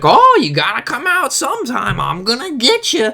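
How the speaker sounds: high-pitched put-on threat; taunting pursuer. Man stopped outside the gym threatening him, high mock taunt.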